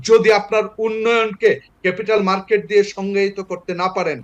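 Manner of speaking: usual speaking voice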